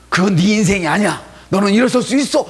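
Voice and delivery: encouraging. Acted. Believer cheering up a crying neighbor, rousing. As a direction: lift them up with your voice.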